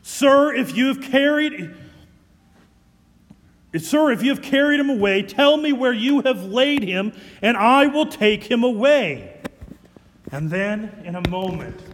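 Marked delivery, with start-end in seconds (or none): 0.0-9.4 loud, urgent, breaking plea; loud desperate plea. Acted. Mary begging the supposed gardener for the body, loud and urgent. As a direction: beg him outright, loud.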